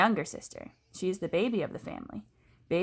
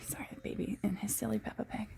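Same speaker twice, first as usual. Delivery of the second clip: hushed whisper aside; 2 semitones higher, 9 dB quieter than usual. Real, not acted. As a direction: drop to a whisper to say sorry. Aside to listener during reading.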